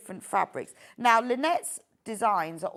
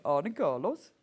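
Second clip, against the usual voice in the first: lightly put-on Norwegian accent, lifted ending; attempted Norwegian accent; 5 semitones lower than usual. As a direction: slip into a mild mock Norwegian accent. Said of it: "I always end up saying".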